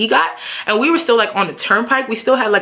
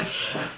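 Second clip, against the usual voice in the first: short, soft huff; exasperated sigh; 15 dB quieter than usual. Retold or imitated. Giving boyfriend the silent treatment, exasperated huff. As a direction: blow out a brief irritated breath. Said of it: "I literally was just like".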